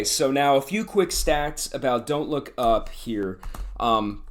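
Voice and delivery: normal talking voice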